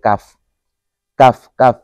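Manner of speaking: কাফ said with a light, ordinary k; plain kaf. Shown as a demonstration. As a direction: say কাফ simply, no heavy throat sound. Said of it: "খালি স্বাভাবিকভাবে বললেই হয়".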